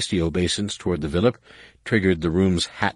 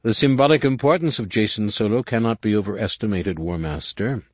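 respectful; 6 semitones higher, 4 dB louder than usual. Acted. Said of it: "he spoke with utmost respect".

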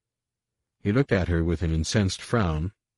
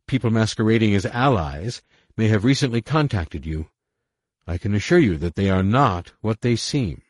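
urgent warning; 5 semitones higher, 5 dB louder than usual. A narrator's reading. Leia warns the general.

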